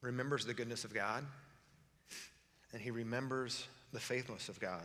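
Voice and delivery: low, soft, tearful voice; choked up, halting with long pauses; real crying. Real, not acted. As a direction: speak quietly through real tears. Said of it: "I'm crying".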